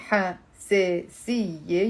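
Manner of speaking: حساسية split slowly, ha, se, si, ye; overly careful حساسية with gaps between syllables. Shown as a demonstration. Teacher showing how nobody says it live, slow syllables. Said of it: "when you're saying it in live".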